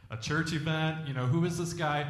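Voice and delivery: ordinary delivery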